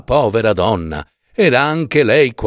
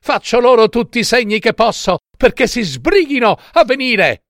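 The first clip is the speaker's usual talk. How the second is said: high, urgent excited voice; excited sister; 10 semitones higher than usual. Acted. Sister signals brothers to hurry, excited. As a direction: call out the good news eagerly, high.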